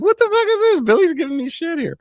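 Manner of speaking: high, incredulous squeal of surprise; mock outrage, voice jumping way up; shocked outburst. To Billy in chat, a high shocked squeal. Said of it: "And I was like".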